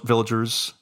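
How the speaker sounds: normal talking voice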